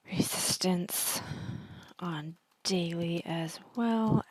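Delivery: slow, halting dictation with pauses; writing it out. Real, not acted. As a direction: say it slowly, as if typing each phrase. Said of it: "like I'm writing a sentence".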